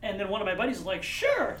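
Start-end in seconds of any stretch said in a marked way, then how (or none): 1.0-1.6 eager sure. Retold or imitated. Friend eagerly agreeing.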